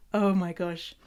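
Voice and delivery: ordinary talk, nothing marked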